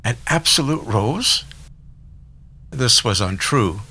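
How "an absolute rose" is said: questioning echo. A narrator's reading. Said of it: "She turned to Miss Baker for confirmation".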